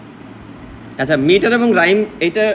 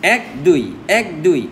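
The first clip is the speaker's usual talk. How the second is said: এক দুই in two separate beats, each pair falling; marching count rhythm; 3 semitones lower, slower than usual. Shown as a demonstration. Teacher showing metre to students, এক দুই chanted in paired beats. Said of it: "বিভিন্ন ছন্দে এটা বলা যায়".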